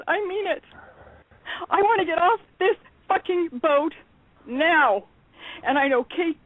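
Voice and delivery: rising, heated rant at listeners; pissed-off rant. Real, not acted. Fed-up guest ranting at listeners, angry. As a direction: vent real frustration, building hard. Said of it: "I'm getting real pissed off".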